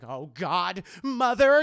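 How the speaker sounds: exasperated cry building to a shout; mad outburst. Retold or imitated. April finding all the kids gone, mad outburst. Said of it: "and she gets very mad".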